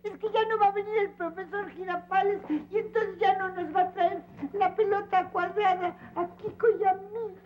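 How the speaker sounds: put-on high, wailing child's sob; crying. Child explaining his tears to the man who scolded him, crying. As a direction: wail the explanation out, voice pitched high. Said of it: "por qué chillas".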